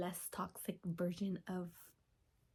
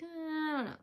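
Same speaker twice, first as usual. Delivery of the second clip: unsure shrug; 8 semitones higher than usual. Retold or imitated. Unsure reply to friends.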